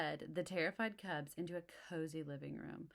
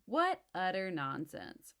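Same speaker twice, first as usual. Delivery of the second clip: slow, loud scoffing protest; 3 semitones higher, 7 dB louder, slower than usual. Acted. Mama scolds the cubs' fears, slow and loud. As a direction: brush it off firmly. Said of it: "protested Mama".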